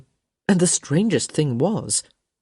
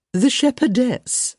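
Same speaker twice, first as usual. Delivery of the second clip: high, slow society-lady prompt; supply it helpfully, pitched up and drawn out; 8 semitones higher, 3 dB louder, slower than usual. A narrator's reading. Mother reminding a guest of her daughter's part, helpful. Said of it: "Lady Plainsworth said helpfully".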